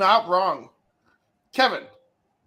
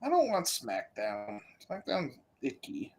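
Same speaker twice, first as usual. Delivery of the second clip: whiny voice; 6 semitones lower, 10 dB quieter than usual. Real, not acted. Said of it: "the little whiny voice there".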